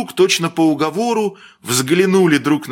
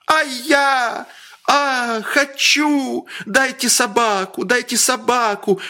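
высокий капризный детский визг; визжи, требуя собаку; визг капризного мальчика; 9 semitones higher, slower than usual. A narrator's reading. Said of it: "взвизгивал мальчик". (капризный мальчик визжит)

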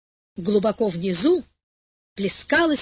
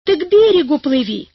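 loud, high urgent shout; 5 semitones higher, 7 dB louder than usual. A narrator's reading. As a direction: yell the order across the water. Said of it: "закричал Карик". (громкий крик)

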